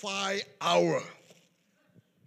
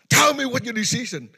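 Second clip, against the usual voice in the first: higher, much louder barked demand; angry demand; 8 semitones higher, 11 dB louder than usual. Retold or imitated. Grandfather furious at grandson, loud angry demand.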